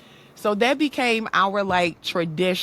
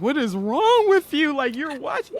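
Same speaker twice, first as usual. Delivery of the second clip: incredulous; 9 semitones higher than usual. Retold or imitated. Child's incredulous look at dad.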